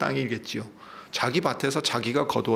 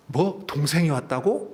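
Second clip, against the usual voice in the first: startled, higher-pitched exclamation; gasp, then ask it in happy disbelief; delighted surprise; 4 semitones higher than usual. Acted. Preacher voices a brother hearing of the return, surprised.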